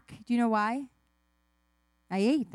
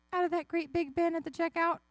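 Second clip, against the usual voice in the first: put-on small, high-pitched little-kid answer; guilty child; 6 semitones higher than usual. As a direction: answer Mom like a kid who's been caught. Guilty child questioned by mom.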